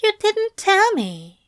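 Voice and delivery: high, reproachful angel voice; hurt angel. A narrator's reading.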